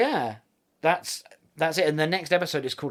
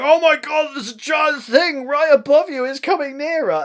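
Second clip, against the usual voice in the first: high, loud panicked shouting; Travis panicking; 13 semitones higher, 11 dB louder than usual. Retold or imitated. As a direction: yell the warning as if the thing is right on top of them. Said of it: "Travis is going".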